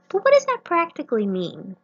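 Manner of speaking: a touch of exasperated disbelief; exasperated question. Retold or imitated. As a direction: push back, baffled. Questioning God retold.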